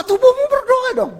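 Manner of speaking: high, pressing call to pray; excited urging. Acted. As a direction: rouse them to pray with the body. Preacher urges praying with body. (seruan bersemangat)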